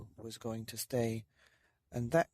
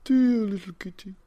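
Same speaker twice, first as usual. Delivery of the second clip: put-on higher, louder old man cooing; old man cooing at the kitten, pitched up; tender old man; 8 semitones higher, 11 dB louder than usual. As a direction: croon it to the kitten. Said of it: "said the very old man".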